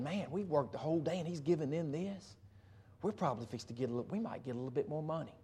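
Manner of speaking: quick, hushed hopeful thinking; eager workers' inner voice. Retold or imitated. Workers whispering to themselves about the pay. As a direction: rattle it off under your breath, hopeful. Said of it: "So they're thinking".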